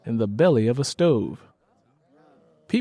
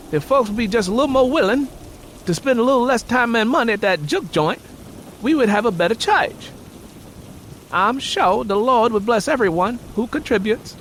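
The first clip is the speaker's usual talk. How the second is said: preacher; 11 semitones higher than usual. Acted. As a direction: preach for the building fund. Preacher continues appeal.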